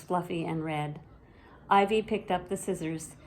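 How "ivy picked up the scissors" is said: usual speaking voice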